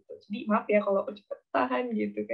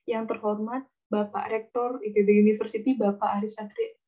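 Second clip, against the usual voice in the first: usual speaking voice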